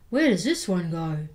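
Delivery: big skeleton. Acted. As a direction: ask it in a gruff puzzled voice. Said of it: "said the big skeleton".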